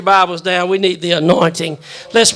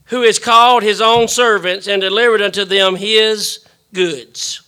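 booming biblical narration; 4 semitones higher, 7 dB louder than usual. Acted. Pastor reads scripture aloud.

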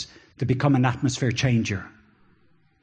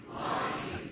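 breathy whisper of longing; 15 dB quieter than usual. Acted. Word repeated as sigh.

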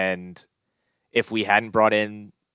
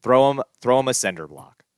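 usual speaking voice

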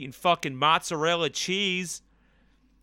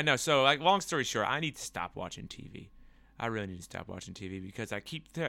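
ordinary talk

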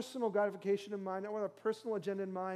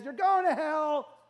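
shouted, higher and louder; mock yell; 6 semitones higher, 8 dB louder, slower than usual. Pastor mimics a church yelling at outsiders, shouting. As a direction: bellow it like a street-corner condemner. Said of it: "that screams and yells and says".

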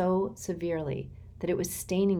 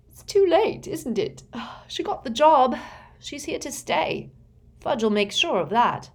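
yawning; 9 semitones higher, 6 dB louder than usual. A narrator's reading. Ron yawning, weary.

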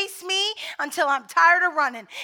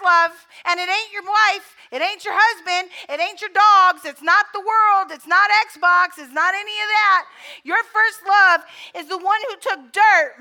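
full-force preaching; 2 semitones higher, 6 dB louder than usual. Real, not acted. Preacher urges the church back to their first love, loud and driving. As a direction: hammer each line home to the congregation.

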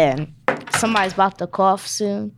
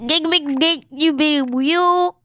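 alien gibberish; 8 semitones higher, slower than usual. Pretend. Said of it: "Is that a language?".

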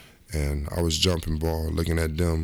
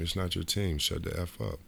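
ordinary talk, nothing marked

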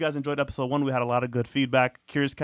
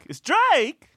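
excited shout; 7 dB louder than usual. Real, not acted. Insisting in argument.

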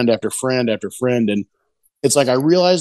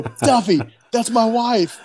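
much higher, excited call across the stage; a delighted higher call to Tuffy; 11 semitones higher, slower than usual. Retold or imitated. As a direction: point it out, thrilled. Pointing out wife next to Tuffy.

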